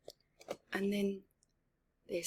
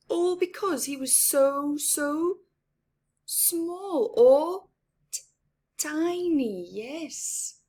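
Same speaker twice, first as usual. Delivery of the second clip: much higher, sing-song reading for little ones; storybook narration; 7 semitones higher, 8 dB louder, slower than usual. A narrator's reading. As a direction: make the mouse sound tiny and sweet. Picture book read aloud.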